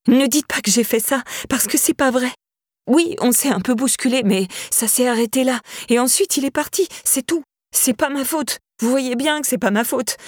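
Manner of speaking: high, pleading, close to tears; panicked high-pitched begging; desperate pleading. A narrator's reading. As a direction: beg them to believe her, voice climbing. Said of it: "entendait sa propre voix monter".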